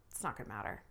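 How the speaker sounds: low, dropped aside. Shown as a demonstration. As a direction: drop "It's not gonna matter" low under the breath. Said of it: "blah, blah".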